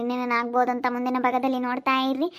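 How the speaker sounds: normal talking voice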